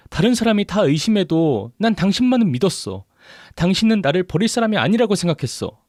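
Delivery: high-pitched, wounded reproach; angry tirade. A narrator's reading. Abandoned wife confronting her husband, angry and hurt. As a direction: rail at him in accusing questions.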